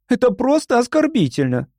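high, offended exclamation; indignant. A narrator's reading. Young woman insulted by the hosts, indignant. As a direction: voice the insult, pitched right up. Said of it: "возмущалась Любочка".

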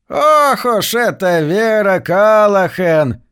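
put-on slow, high old lady's crowing; triumphant exclamation. A narrator's reading. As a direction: draw it out in high-pitched triumph. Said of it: "торжествующе воскликнула старая мисс". (торжествующий возглас старухи, высоко и протяжно)

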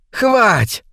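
high-pitched monster shriek; creature's screech. A narrator's reading. Creature screeching in his face, high screech. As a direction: screech it high as you seize your prey. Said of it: "пещера рта и провизжала".

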